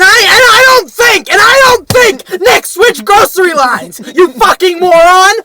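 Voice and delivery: furious shouting rant; angry rant. Retold or imitated. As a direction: yell at the idiot in line. Ranting about slow line.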